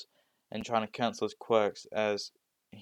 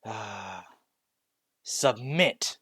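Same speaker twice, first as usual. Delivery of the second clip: enraged command; 4 dB louder, much slower than usual. Acted. Deku in a rage ordering Kurogiri, angry command. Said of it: "in a fit of rage".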